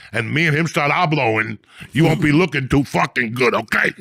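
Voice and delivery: loud, laughing, worked-up threats; fired up rant. Retold or imitated. Threatening to call the lieutenant, fired up. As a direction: keep ranting, half laughing. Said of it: "I got fired up".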